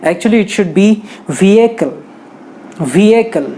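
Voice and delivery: vehicle said slowly as VEE-ekl, no h; correct pronunciation. Shown as a demonstration. Teacher corrects vehicle slowly for students. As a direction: vehicle stretched out without the h. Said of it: "ये गलत है".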